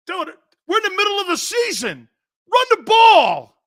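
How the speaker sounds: high, loud mock protester shout. Philly fan yells at the news reporter, high and loud. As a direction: blow up at the reporter.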